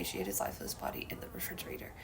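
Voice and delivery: hushed whisper. Real, not acted. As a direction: drop to a whisper. Reading grim news article.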